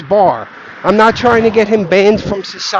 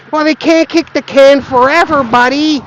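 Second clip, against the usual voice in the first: higher, sneering quote of a know-it-all; mocking the doom crowd; 5 semitones higher than usual. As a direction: parody the smug warning. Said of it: "your fucking claims about".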